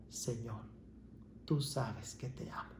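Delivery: soft, near-whispered sad answer to Jesus; a hurt, hushed confession of love; saddened reply. Acted. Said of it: "entristecido por tanta pregunta".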